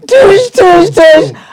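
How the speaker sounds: loud "doosh" crashes; high, loud mimicked clanging; imitated banging. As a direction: imitate clashing metal at full volume. Imitates banging cylinders in playground.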